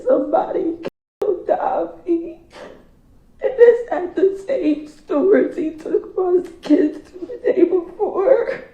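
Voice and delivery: crying through it, barely holding on. Real, not acted. Recalls the news, crying.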